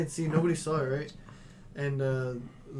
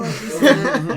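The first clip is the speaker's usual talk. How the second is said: loud laughing aside; 8 semitones higher, 13 dB louder, slower than usual. Real, not acted. Telling friends about the thief, laughing loudly. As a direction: burst out laughing mid story.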